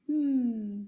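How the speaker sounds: ordinary talk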